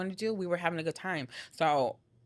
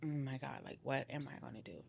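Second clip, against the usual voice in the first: much softer, as if to herself; hushed dismay; 3 semitones lower, 12 dB quieter than usual. Retold or imitated. Woman recalling her fear of him, hushed dismay. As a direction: drop to a low-volume worried thought. Retelling her scared private thought.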